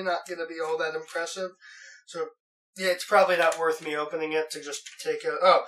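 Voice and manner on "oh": normal talking voice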